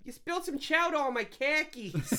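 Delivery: a hammy Boston guy complaining; Boston accent. Pretend. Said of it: "Sounds like something Boston would say".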